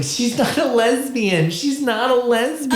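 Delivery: mock high-pitched girl protesting; girly voice. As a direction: play the girlfriend, squeaky and insistent. Girl's voice quoted in story.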